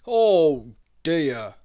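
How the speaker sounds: a loud, lightly voiced doctor sighing over his patient; hint of a concerned old doctor. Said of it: "said the doctor".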